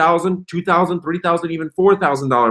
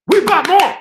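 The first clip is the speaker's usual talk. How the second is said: "We bought more" shouted much higher and louder; excited yell; 14 semitones higher, 5 dB louder than usual. Real, not acted. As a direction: belt it out in triumph. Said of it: "repeated with escalation".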